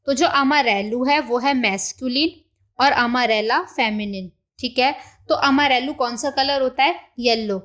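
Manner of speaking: usual speaking voice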